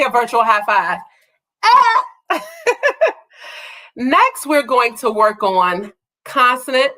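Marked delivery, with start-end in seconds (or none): 1.6-2.0 excited squeal. Real, not acted. High five celebration.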